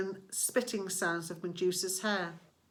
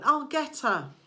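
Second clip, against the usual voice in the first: higher, excited battle yell; 6 semitones higher, 3 dB louder than usual. A narrator's reading. Grover swooping down on Medusa, eager yell. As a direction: charge in shouting. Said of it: "he yelled again".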